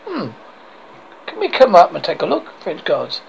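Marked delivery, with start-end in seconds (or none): none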